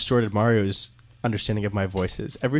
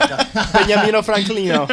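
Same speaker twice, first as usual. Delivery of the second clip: mock Italian; 9 semitones higher, 4 dB louder, slower than usual. Italianizing Franklin's name as joke.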